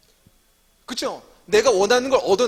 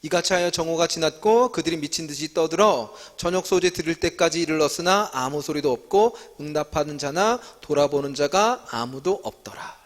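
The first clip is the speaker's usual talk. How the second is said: measured, slightly slower Bible reading; scripture reading; 4 semitones lower, 3 dB quieter than usual. Acted. Teacher reading 1 Kings aloud to the class, steady scripture reading. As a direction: read the passage out evenly, like a responsive reading.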